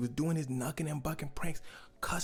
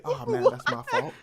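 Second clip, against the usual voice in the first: much higher, loud, laughing apology; mock apology; 7 dB louder than usual. Narrator teasing the audience, a laughing high mock apology. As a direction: squeal it like a joke. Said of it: "Did he say".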